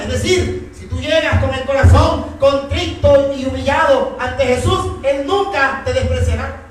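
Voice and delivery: predicación apasionada, más aguda y fuerte; proclámalo desde el púlpito con fervor; predicación intensa. Acted. Predica con fuerza creciente. (sermón a plena voz)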